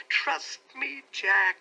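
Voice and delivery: high, urgent plea; wife pleading with her husband; pleading cry. A narrator's reading. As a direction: cry it out, begging. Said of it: "she cried".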